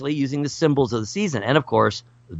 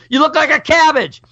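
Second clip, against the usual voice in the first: much higher, louder teasing squeal; 14 semitones higher, 4 dB louder than usual. Pretend. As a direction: squeal it like a playful jab. Mock remark at blindfolded person.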